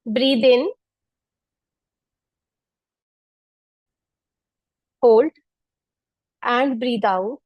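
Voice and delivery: calm, spaced breath cues; guided breathing cues. Acted. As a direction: let each cue hang for the breath. Counts breaths for class. (शांत श्वास संकेत)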